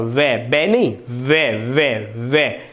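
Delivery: correcting वे against बे; वे not बे. Shown as a demonstration. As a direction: stretch वे so the lip-teeth friction shows. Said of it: "दांत और ओष्ठ से वे बोला जाता है".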